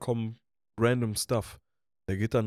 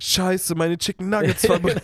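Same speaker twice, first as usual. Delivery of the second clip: louder, higher mock panic; 5 semitones higher, 6 dB louder, slower than usual. Voicing the coworker who burned his food in mock panic. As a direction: act out the stoned guy's panic.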